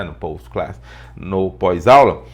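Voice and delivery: usual speaking voice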